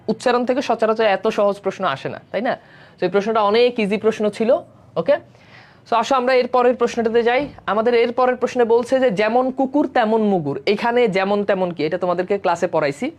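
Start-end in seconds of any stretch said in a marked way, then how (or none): none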